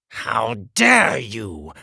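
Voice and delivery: slow, loud indignation; outraged king, each word drawn out; spat in outrage. Acted. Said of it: "the king spat".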